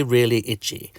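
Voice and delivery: ordinary delivery, nothing marked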